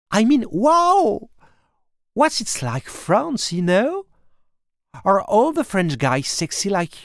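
put-on high, gushing girl voice, rising to squeals of wow; gushing teen girl. Lily gushing at the French new boy, high and excited. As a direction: play the starstruck American girl meeting a French boy. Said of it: "Conversation with Lily".

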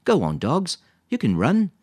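loud, high send-off to the dogs; calling out. A narrator's reading. Lisa sets her dogs free to run, calling out. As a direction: call out to release them.

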